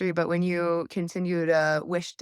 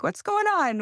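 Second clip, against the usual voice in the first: mock bewildered; 10 semitones higher than usual. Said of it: "I'm just over here like".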